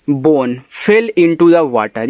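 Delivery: reads the English very slowly, word by word; slow classroom read-aloud. Shown as a demonstration. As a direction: model the sentence for learners. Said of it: "अब".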